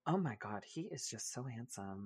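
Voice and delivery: very soft mock swoon; hushed gushing. Quoting onlookers who kept admiring the boyfriend, hushed gushing. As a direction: imitate admirers gushing under their breath.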